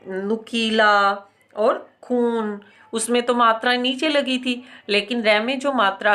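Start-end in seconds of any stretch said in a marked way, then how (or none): 0.1-2.6 नुकीला और खून said slowly; slowly recalled example words. Shown as a demonstration. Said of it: "पढ़ा था ऊ की मात्रा में".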